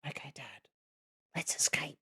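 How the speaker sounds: breathless child's whisper, barely voiced; retold whisper. Daughter at the front door whispering to dad, a hushed whisper. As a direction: whisper it like a kid plotting a secret. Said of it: "she whispered to me".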